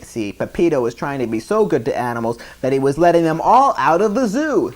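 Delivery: plain reading voice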